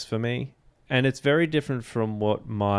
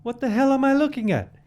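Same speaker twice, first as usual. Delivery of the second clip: high, fast strained villain voice; bad movie reenactment; 3 dB louder, faster than usual. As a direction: squeak out a panicky villain, much higher. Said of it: "that was a bad reenactment of the movie".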